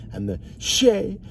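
high, drawn-out ad-lib yelp; ad-lib imitation. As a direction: copy the rapper's ad-lib. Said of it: "his best ad lib".

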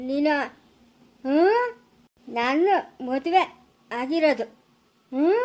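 halting, insistent stammer; stammered demand. Acted. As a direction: stutter on first sounds. Stammering man demands hand be removed.

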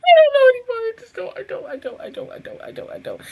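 high wail fading into a whisper; wail, then dwindle to voiceless repeats; acted despair. Viewer showing how the show felt, acted breakdown. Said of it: "This is how I felt".

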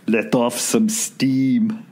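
slow, heavy Austrian one-liner; deadpan Austrian action-hero quip; slow Schwarzenegger drawl, lightly done; Schwarzenegger impression. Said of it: "Schwarzenegger would ... be like".

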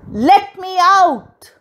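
loud, high, slow cry; drawn-out high yell; bellowed demand. A narrator's reading. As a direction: holler to be set free. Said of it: "She bellowed".

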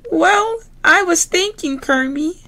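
very high squealy piggy voice; Miss Piggy voice. Acted. Miss Piggy to classmates, very high pig voice. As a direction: play the diva pig, high and bright.

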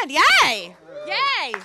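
cheering. Real, not acted. Mother cheering her son's team at the NCAA, loud yays. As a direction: cheer like a proud swim mom.

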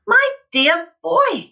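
slow, high, loud exclamation; excited cry. A narrator's reading. Excited father exclaiming to his boy, crying out slowly. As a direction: draw it out grandly and loudly. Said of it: "cried Mister Killycranky".

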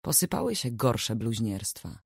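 ordinary delivery, nothing marked

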